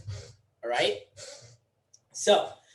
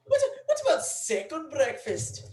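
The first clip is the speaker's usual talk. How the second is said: put-on high-pitched, laughing Scottish accent; mock Scottish accent; 10 semitones higher than usual. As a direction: giggle through a put-on Scottish brogue. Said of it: "It's a Scottish accent".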